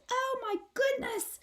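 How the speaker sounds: very high alarmed cry; scream. Acted. Mother shrieking at a wild possum, very high. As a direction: shriek in fright. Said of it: "she screams".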